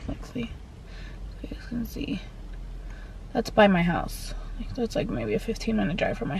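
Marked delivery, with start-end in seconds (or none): 0.1-2.2 hushed aside to camera; whisper. Real, not acted. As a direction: drop to a soft whisper. Quietly showing the camera.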